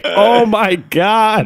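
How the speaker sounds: high, long drawn-out groan of horror; mock dismay. Teen hearing Jared gave them away, mock dismay. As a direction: wail it slowly, hammed-up despair. Retold dismay at friend's drunken giveaway.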